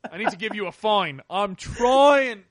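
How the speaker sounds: loud, high, hammed-up British constable; terrible British accent. Constable fining someone mid-vomit, in terrible British accent. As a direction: strain through a bad British accent, mock exasperated. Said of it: "my terrible British accent".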